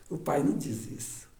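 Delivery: usual speaking voice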